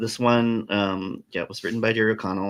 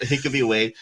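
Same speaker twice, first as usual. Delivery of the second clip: usual speaking voice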